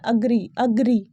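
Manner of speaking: अग्री opening on a short "uh"; correct pronunciation. Shown as a demonstration. Teacher giving the right form, correct pronunciation. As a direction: say अग्री as uh-GREE. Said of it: "correct pronunciation होता है".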